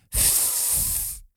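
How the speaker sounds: voiceless puff of air; soft, breathy raspberry-like puff; little girl's puff. A narrator's reading. Little girl babbling on a lap, a soft puff. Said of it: "zei ze".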